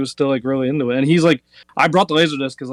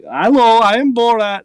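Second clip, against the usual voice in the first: higher, louder bad Borat accent; a poor Borat accent, pitched up; 8 semitones higher, 5 dB louder than usual. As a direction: fan doing a lame Borat accent. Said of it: "I can do Borat".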